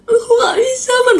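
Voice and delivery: high, half-joking sob; fake crying. Real, not acted. Streamer fake-cries over a friend's cheating boyfriend. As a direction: whine it like you're about to cry. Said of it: "Bukan nangis-nangis lah ya".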